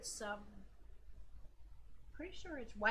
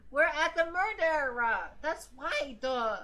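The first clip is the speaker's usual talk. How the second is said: dopey Gumshoe; 2 semitones higher, 6 dB louder than usual. Pretend. Player voicing the detective answering, loud mock dopey. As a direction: stretch it out loud like a dim cop. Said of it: "Gumshoe voice".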